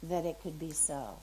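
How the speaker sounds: softly imitated tearful wish; retold weeping. Man told he will marry, retold weeping. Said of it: "like, weeping and sobbing and crying".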